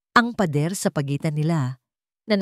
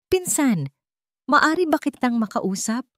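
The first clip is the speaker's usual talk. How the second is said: higher, anxious plea to her cousin; worried cousin; 8 semitones higher than usual. Acted. Clarita approaching Gideon in his office, worried and high. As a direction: sound concerned as you ask to talk. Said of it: "Ang boses nito ay puno ng pag-aalala".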